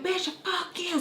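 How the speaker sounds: high-pitched retold cuss-out; mock yelled insult. Retold quarrel, cursing her out.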